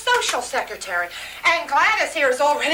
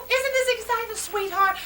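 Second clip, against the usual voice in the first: excited; 7 semitones higher than usual. Acted. Mother repeats it, even more thrilled.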